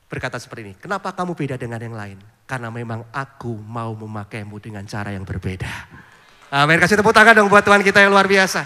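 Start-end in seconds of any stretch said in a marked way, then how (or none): 0.8-5.7 gentle inner voice. Retold or imitated. God's quiet voice answering the pastor's prayer, softer and lower. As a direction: lower and softer, tender and calm: "Kenapa kamu beda dengan yang lain?". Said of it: "ada suara lembut di hati".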